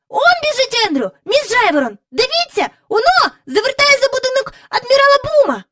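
put-on very high, loud, fast boy's call; excited child shout. A narrator's reading. Michael calling to Miss Lark, high excited shout. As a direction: point and shout it eagerly.

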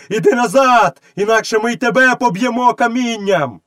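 high, threatening mob cry; crowd roaring. A narrator's reading. Crowd threatening to stone the woman, roaring. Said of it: "заревів натовп".

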